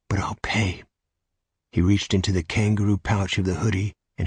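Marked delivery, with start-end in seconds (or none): 0.1-0.8 whispered. Acted. Stranger whispers his offer.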